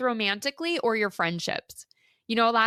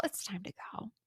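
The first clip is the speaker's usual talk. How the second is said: whisper; 2 semitones lower, 14 dB quieter than usual. Real, not acted. Confiding advice to listener.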